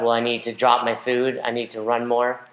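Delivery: ordinary talk, nothing marked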